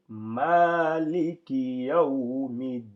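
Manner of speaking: slow recitation, long "Ma" in "Maliki"; lengthened "Ma". Shown as a demonstration. Teacher showing the other version, chanted with lengthened "Ma". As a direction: hold the first vowel of "Maliki" while chanting. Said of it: "sert d'outil d'allongement".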